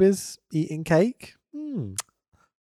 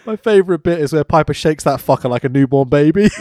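ordinary talk

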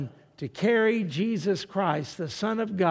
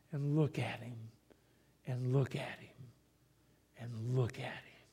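each look at him softer and lower; fading repetition; 5 semitones lower, 11 dB quieter than usual. Real, not acted. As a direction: let it sink away, quieter each time. Repeated, fading plea at the cross.

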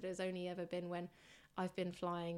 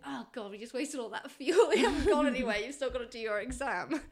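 laughing exasperation; 4 semitones higher, 9 dB louder than usual. Retold or imitated. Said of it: "you're there thinking".

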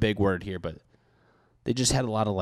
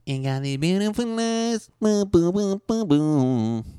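silly falsetto; 11 semitones higher, 6 dB louder, slower than usual. Pretend. Silly high voice.

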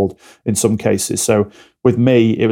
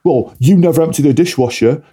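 louder, higher blaming jab; accusing partner; 5 semitones higher, 4 dB louder than usual. Retold or imitated. Partner reversing roles with a dishwasher jab, accusing. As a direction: throw the blame back. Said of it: "then they reverse the roles".

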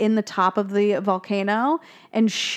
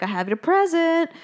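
higher, lilting gift announcement; mock-cheerful present giving; cheery dentist; 8 semitones higher than usual. Dentist offering the girl her present, lilting.

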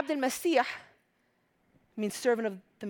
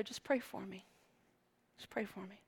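quiet plea; 4 semitones lower, 15 dB quieter than usual. Retold or imitated. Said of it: "And he said to her".

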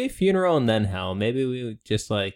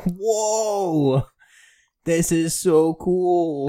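put-on stoked teen dude; 6 semitones higher, 4 dB louder, much slower than usual. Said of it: "And he's like".

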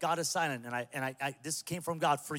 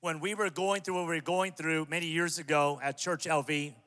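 ordinary talk, nothing marked

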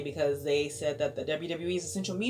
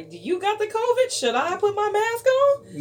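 high, loud mock panic; teasing fake fright about covid; mock alarm; 8 dB louder than usual.

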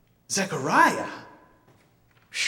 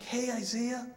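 tender to newborn; 6 semitones higher, 6 dB quieter than usual. Retold or imitated. Father speaking to newborn son.

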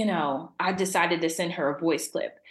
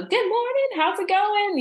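much higher, brighter sing-song hello; cheery greeting; 13 semitones higher, 3 dB louder than usual. Retold or imitated. Voicing her good morning voice clip to friends, high and cheery. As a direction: chirp it like a morning text.

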